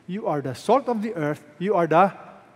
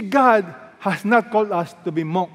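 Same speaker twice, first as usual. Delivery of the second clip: ordinary delivery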